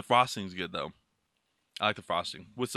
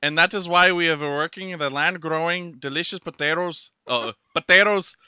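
put-on lilting Irish accent. Man reads a chip packet aloud in mock Irish. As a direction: read it in a hammed-up Irish brogue. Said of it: "it sounds Irish".

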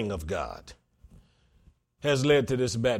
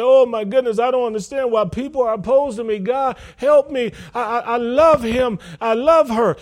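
high, loud imitation of a desperate plea; put-on anguished protest; mock pleading; 8 semitones higher, 6 dB louder than usual. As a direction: beg God in a hammed-up voice. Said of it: "and plead and say".

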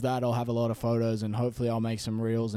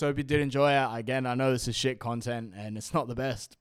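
ordinary talk, nothing marked

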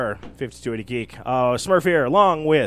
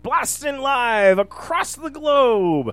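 announcer; 6 semitones higher, 3 dB louder, slower than usual. Acted. Show intro announcer.

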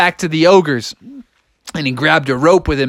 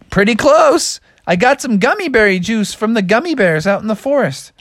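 higher-pitched, eager frog voice; excited frog; 6 semitones higher than usual. Acted. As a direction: explain proudly in a high frog voice. Froggy answers excitedly.

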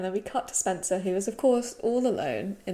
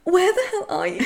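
high, loud, whiny imitation; mock whine; 9 semitones higher, 7 dB louder than usual. He calls out for her, exaggerated whine. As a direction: whine it like a dog, laughing. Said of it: "whines like a dog. He goes".

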